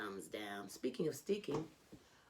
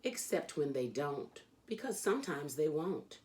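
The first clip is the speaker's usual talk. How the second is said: lively, sing-song reading of a rhyming picture book; animated children's book read-aloud; storybook reading; 2 semitones higher, slower than usual. Acted. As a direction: read it like a bedtime story for a kid. Reads Dr. Seuss aloud.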